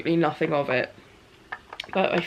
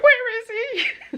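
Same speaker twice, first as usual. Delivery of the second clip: high, slow, laughing question; excited disbelief; much slower than usual. Retold or imitated. Wife imitating husband asking where his friend is, high laughing disbelief. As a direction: drag it out, thrilled and tearful.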